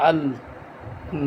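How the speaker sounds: one isolated syllable at a time; syllable-by-syllable recitation demo; broken-up syllables. Shown as a demonstration. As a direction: leave a clear pause after each syllable. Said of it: "ভাঙ্গা ভাঙ্গা উচ্চারণ করব".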